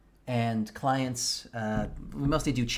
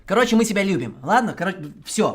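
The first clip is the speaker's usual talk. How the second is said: loud apology; 8 semitones higher, 9 dB louder than usual. Real, not acted. Softening after the outburst.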